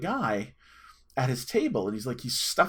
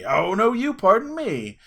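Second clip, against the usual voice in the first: high, loud, drawn-out comeback; escalated mock fighting; 8 semitones higher, 7 dB louder, slower than usual. Laughing stranger fires back over manners, mock outraged. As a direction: top the challenge, pitched up and louder. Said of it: "we put our dukes up".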